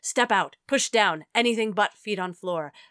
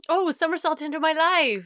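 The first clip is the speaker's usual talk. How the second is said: somersault into my life repeated brighter and louder; delighted echo; 6 semitones higher, 4 dB louder than usual. Real, not acted. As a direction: relish somersault into my life with glee. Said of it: "echoes the haiku line".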